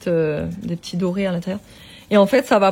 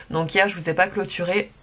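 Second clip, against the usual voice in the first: ordinary delivery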